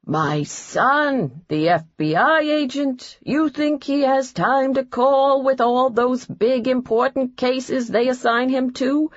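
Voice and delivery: imitating his mother in a thick New York accent, complaining; a put-upon New York mom, griping about her son. Mother griping her son never calls, thick New York accent. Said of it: "He adopted a thick New York accent".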